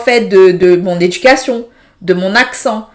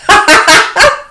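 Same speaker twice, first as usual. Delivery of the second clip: laugh; 8 semitones higher, 4 dB louder than usual. Real, not acted.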